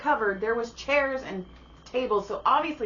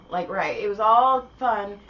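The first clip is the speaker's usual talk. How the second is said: normal talking voice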